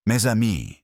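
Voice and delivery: put-on lightly French-accented, poised and certain; a hint of Poirot's French, steel under silk. Poirot addressing the suspects, a light French accent. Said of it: "his accented voice carrying the silk-wrapped steel".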